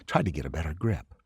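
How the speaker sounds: plain reading voice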